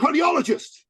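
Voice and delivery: high, loud proud announcement; proud eager answer. Retold or imitated. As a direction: blurt it out proudly as a mock client. Dan proposing niche proudly.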